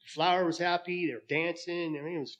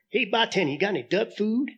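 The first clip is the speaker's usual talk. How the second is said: duck voice; 7 semitones higher than usual. Acted. Duck asks bartender in joke.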